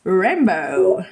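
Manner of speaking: low, drawn-out English "rainbow"; playful English echo. Retold or imitated. Echoes child's English word playfully.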